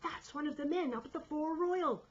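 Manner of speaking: high, loud report; alarmed shout. A narrator's reading. Sailor reporting man aloft, alarmed shout. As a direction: shout the news up urgently. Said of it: "I shouted".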